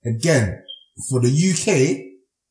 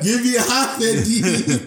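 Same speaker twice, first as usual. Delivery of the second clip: laughing; 9 semitones higher, slower than usual. Real, not acted. Laughing high plea.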